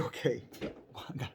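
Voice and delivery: breathy laugh through the words; laughing sheepishly. Acted. Abraham laughing sheepishly to the angels, breathy giggle. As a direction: giggle it out under your breath.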